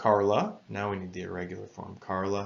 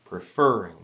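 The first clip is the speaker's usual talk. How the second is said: "Preferring" with FER hit loud, pre and ring light; stress on the second syllable; 8 dB louder than usual. Shown as a demonstration. Teacher showing the doubled-consonant form, loud FER in "Preferring". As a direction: land firmly on FER in "Preferring". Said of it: "Listen to how the stress is at the end".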